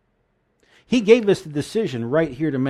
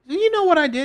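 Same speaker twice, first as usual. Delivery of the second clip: much higher, louder pleading question to God; raised, high-strung retold cry; retold incredulous question; 6 dB louder than usual. Elder asking God about his mistake, high retold plea.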